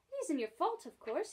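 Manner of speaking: usual speaking voice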